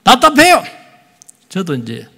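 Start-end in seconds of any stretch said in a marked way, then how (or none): 0.0-0.6 답답해요 cried out high and loud, falling; stifled cry. Acted. Pastor to congregation, 답답해요 cried high and loud. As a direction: wail 답답해요 loudly then drop. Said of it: "아름다운 소리가 나는 거예요".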